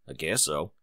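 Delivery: low, quiet reluctant mumble; indifferent big stallion; lightly voiced laconic stallion; low, soft big-brother shrug. A narrator's reading.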